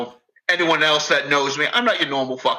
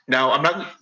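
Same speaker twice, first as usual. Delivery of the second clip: usual speaking voice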